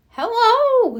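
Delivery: high, lightly voiced kindly old woman; old lady hello. A narrator's reading. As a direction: greet the pups sweetly. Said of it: "said an older woman".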